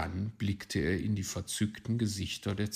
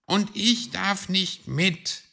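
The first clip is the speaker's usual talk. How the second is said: high, loud sulky complaint; disgruntled little hedgehog; grumbling; 12 semitones higher, 7 dB louder than usual. Acted. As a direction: sulk like a child left out. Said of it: "murrte Borstel".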